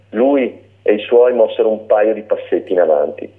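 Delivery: ordinary delivery, nothing marked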